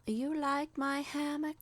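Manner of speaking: a smiling neighbour voice; Doreen, smiling. A narrator's reading. Doreen teasing the narrator about her hammock, smiling. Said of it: "smiling down at me".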